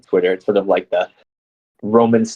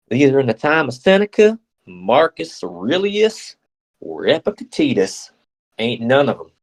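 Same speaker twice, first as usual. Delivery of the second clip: ridiculous American accent; 5 semitones higher than usual. Shown as a demonstration. Redoing the list of Stoics to hide mispronunciation, ridiculous American accent. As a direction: ham up an over-the-top American accent, names stretched. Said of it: "start putting on a ridiculous American accent".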